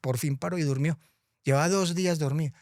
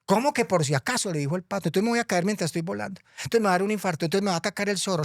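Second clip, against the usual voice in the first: high, fretful little duck; anxious duck voice; 6 semitones higher than usual. Acted. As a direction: rattle off worries like a scared duck. Pato alarmado. (patico asustado, voz aguda)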